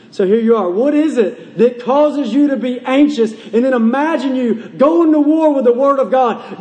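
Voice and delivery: fervent pulpit exhortation; impassioned preaching. Real, not acted. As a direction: preach it hard, driving each phrase at the congregation. Preaches at full force.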